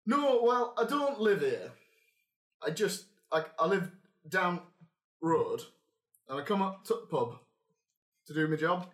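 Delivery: clipped Northern dialect from the innkeeper; northern accent. Acted. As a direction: GM as barkeep, explaining in a Northern accent that he doesn't live here. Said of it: "You're missing a couple of words there".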